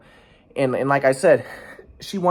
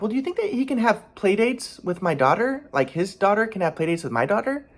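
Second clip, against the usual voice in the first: higher-pitched mimicked woman asking; lilting mock request, pitched up; imitating a coaxing request; 7 semitones higher than usual. Retells her hopeful request for play dates.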